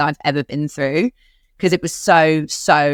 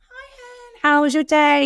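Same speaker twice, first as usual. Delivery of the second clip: much higher, put-on breezy phone voice; mock casual phone greeting; 10 semitones higher, slower than usual. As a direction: sound falsely cheerful while hiding the news. Faking normal phone call to boyfriend.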